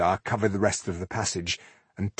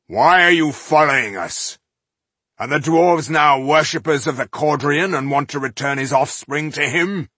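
suspicious dwarf; 6 semitones higher, 6 dB louder than usual. Acted. Stranger challenged in darkness.

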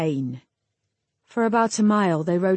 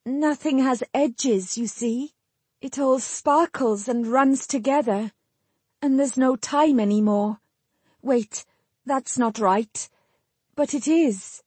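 high, halting voice of a dazed woman; struggling for words; 6 semitones higher than usual. A narrator's reading. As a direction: grope for each phrase, pausing between them. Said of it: "struggling with the unfamiliar task of forming sentences".

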